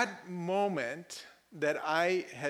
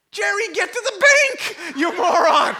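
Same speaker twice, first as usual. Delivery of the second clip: high, very loud comic yelling; hammed-up exasperated bellow; exasperated shout; 14 dB louder than usual. Pastor orders usher to bank.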